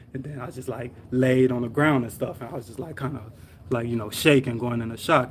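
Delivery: ordinary delivery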